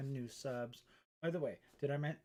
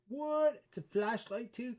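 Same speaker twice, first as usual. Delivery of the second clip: high, loud surprised yelp; 10 semitones higher, 6 dB louder, slower than usual. Real, not acted. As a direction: genuine shock at a discovery. Discovers keychain flashlight.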